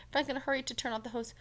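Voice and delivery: ordinary delivery, nothing marked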